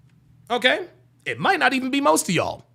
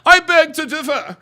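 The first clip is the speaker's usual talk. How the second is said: loud, higher mock-proper voice; fake proper talk; 6 semitones higher, 5 dB louder than usual. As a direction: ridicule affected correct diction. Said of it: "that fake ass proper talking ass".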